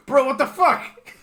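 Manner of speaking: high, loud, laughing disbelief; shocked shout. Acted. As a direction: yell it in stunned disbelief. Guard shouting from across church.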